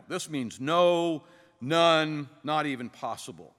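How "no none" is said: "no" and "none" each drawn out and set apart; emphatic no. Shown as a demonstration. Preacher explaining the Greek emphatic no, stressed and drawn out. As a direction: land firmly on "no", then "none", holding each word. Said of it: "this is the emphatic no".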